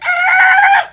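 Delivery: very high, loud held shriek; imitated screech. Driver peeling through people, loud high imitated screech. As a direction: screech like tearing through a crowd. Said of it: "like, peeling through people".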